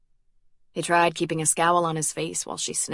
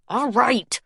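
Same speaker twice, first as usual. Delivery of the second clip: irritable; 6 semitones higher, 3 dB louder than usual. A narrator's reading. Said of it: "she said irritably".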